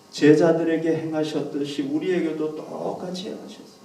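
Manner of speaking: usual speaking voice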